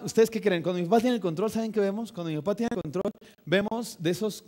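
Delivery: ordinary talk, nothing marked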